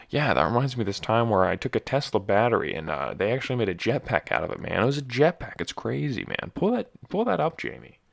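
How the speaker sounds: excitable podcast host impression, pitch swooping up; Joe Rogan. Host marvels at a jetpack to guest, hammed-up impression. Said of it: "That's my Joe Rogan impression".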